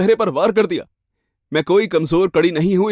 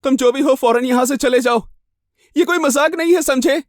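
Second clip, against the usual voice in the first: high, raised voice warning off an intruder; frightened challenge; 10 semitones higher, 4 dB louder than usual. A narrator's reading. Frightened woman ordering an intruder out of her room, high and urgent. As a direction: order them out in alarm. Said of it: "लड़खड़ाती आवाज में पूछा".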